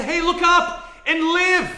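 loud, high, slow call to look up; urgent call. Retold or imitated. Bystander calling to a bitten man, loud and slow.

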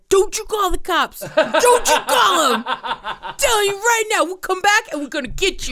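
high, loud mobster bear threat with laughter; mock yell. Bear threatening the truck owner, loud mock yell. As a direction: bark the threat like a tough guy. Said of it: "he yells at the guy".

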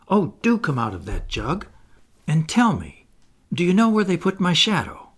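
put-on young boy pleading with the fairy; eager boy Peter. A narrator's reading. Boy begging Tinker Bell for his shadow, lightly voiced eager boy.

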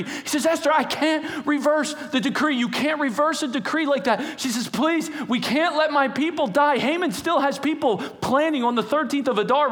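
high pleading voice; pleading queen. Acted. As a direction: beg the king desperately. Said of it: "she starts to plead with him".

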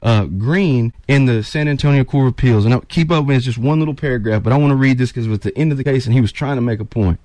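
usual speaking voice

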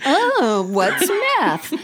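high, laughing, wide-eyed question; naive innocent question. Retold or imitated. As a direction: ask it clueless through a laugh. Bonnie's naivety mimicked, laughing.

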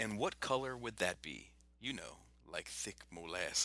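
slow, low and soft quoted line; movie quote. Acted. Quoting the film back, slow and hushed.

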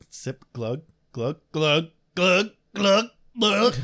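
glugging sounds mimicked; gulping noises. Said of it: "imitating drinking water".

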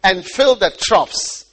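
loud, slow, emphatic Bible reading; scripture reading. Acted. Preacher reading of Midian's priest and daughters, loud proclamation. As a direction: proclaim the verse slowly and forcefully.

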